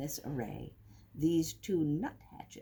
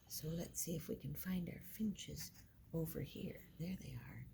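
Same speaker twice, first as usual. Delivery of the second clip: hushed, peering for the finches; softly whispering; 12 dB quieter than usual. Real, not acted. Reader looking for finches with the children, whispering. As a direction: whisper while searching the picture. Searching picture for finches quietly.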